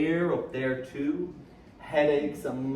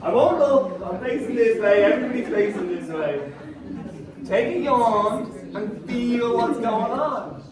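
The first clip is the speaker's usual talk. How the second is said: loud; 7 semitones higher, 7 dB louder than usual. Real, not acted. Coaxing a crowd loudly.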